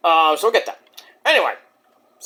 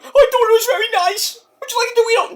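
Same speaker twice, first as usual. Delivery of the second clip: high, put-on goofy old-wrestler voice; pitched-up goofy wrestler impression; Mick Foley impression; faster than usual. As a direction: offer the Dorito in a silly pitched-up voice. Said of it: "What do you think about that, Mick".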